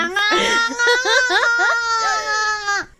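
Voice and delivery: high, loud shrieking laugh; loud burst of laughter. Real, not acted. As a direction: cackle out loud at the chat. Big laugh at chat question.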